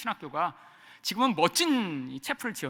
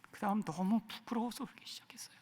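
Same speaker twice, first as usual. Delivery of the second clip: ashamed whisper; 12 dB quieter than usual. Real, not acted. Confessing shame.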